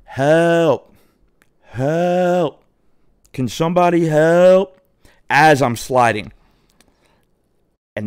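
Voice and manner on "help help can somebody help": high, drawn-out call; retold plea, calling out for anybody; stretched-out mock cry for help; mock yell for help. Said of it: "I yell again".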